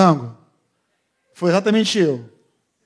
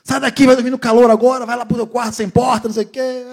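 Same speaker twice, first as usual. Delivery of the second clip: high, sing-song furious scolding; mocking angry rant; 9 semitones higher, 4 dB louder than usual. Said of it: "Falei coisa pra caramba". (bronca furiosa e cantada, aguda)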